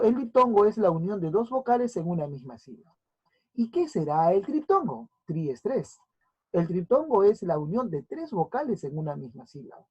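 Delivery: normal talking voice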